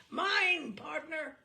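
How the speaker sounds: a high, loud screeching call on the first word; mock a bishop's shrill screech at tennis. Bishop screeching at his partner on the court, a high loud mock screech. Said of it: "with a loud screech of".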